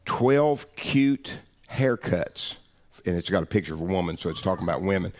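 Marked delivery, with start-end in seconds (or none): 0.0-2.5 slow, higher, word-by-word readout; deliberate, drawn-out mock headline; reading a headline. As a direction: spell out the headline slowly for the listeners. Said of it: "it says".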